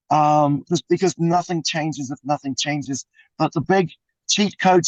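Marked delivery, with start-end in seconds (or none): none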